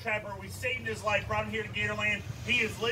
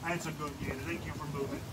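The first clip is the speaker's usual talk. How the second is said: lower, softer praise; 8 semitones lower, 8 dB quieter, faster than usual. Real, not acted. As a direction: gently thank the gator. Praising gator that moved away.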